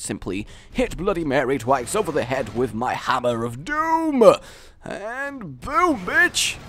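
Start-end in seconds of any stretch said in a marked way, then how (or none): 3.4-4.2 mock epic cry. Epic hammer strike in game. 4.8-6.6 triumphant whoop. Real, not acted. Triumphant kill in game.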